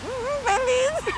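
put-on high, slow baby voice. Shown as a demonstration. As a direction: coo it like a little baby. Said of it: "faça a voz de bebê".

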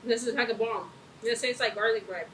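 words between chews; talking while chewing. Real, not acted. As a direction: mouth partly full of bread. Seen 0: Bit naan at -5; still chewing, naan in hand while talking.

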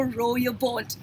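ordinary talk, nothing marked

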